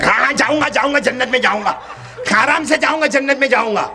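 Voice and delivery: high, irritated snapping retort; irritated husband. A narrator's reading. Annoyed husband snaps back at his wife's questions. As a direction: brush off the nagging wife with annoyance. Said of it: "وہ تو غصے میں تھا".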